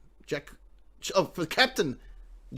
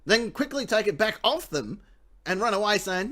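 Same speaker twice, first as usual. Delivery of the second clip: usual speaking voice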